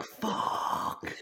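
long, drawn-out whispered curse; mock dismayed whisper. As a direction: imitate a team's hushed despair. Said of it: "the whole P R team was like".

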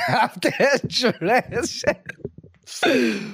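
high, drawn-out words through laughter; real laughter overtaking the read; laughing while reading. Real, not acted. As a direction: break up laughing mid-sentence. Cracks up reading the question.

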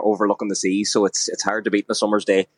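usual speaking voice

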